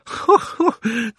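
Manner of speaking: put-on a high, gloating old man's cackle; gleeful cackle. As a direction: laugh like a triumphant schemer. Gloating over his luck.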